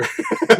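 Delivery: squealing laugh at the dog rolling over; high squeal of laughter. Real, not acted.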